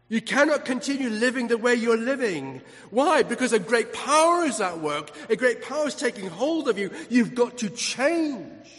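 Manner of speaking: loud, high, urgent sermon delivery; impassioned preaching. Real, not acted. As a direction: preach repentance at full force. Preaches a call to repent.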